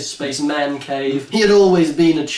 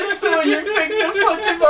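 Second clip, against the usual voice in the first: laughing retold quote. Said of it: "I was like".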